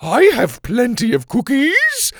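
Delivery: very high, delighted character voice; beaming sweetness. A narrator's reading. Sloppy Joe offers dazed Doctor Rex a treat, beaming. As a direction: offer it brightly, high and eager. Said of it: "Joe beamed".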